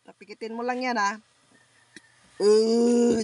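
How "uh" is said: loud groaning grunts. Real, not acted. Healer grunting loudly over the patient in trance. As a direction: push out each grunt from the chest.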